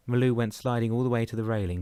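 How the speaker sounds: plain reading voice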